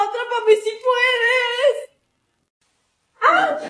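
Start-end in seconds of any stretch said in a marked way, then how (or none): 0.1-1.8 playful taunt. Pretend. Kids playing chase game.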